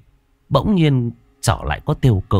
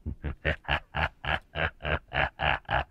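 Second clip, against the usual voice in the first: slow, low, soft demonic laugh; low evil chuckle; 7 semitones lower, 12 dB quieter than usual. Acted. Demon gloats over the terrified boy, evil low laughter. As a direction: chuckle darkly in a low pulse.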